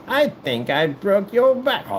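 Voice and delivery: high, slow, drawn-out villain imitation; Bane. Mocking the film he hated, slow high villain. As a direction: stretch it into a mock movie-villain voice. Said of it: "that Batman and Bane".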